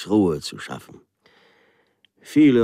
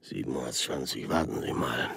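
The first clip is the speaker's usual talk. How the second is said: musing murmur; 7 semitones lower, 9 dB quieter than usual. Acted. Official muses quietly.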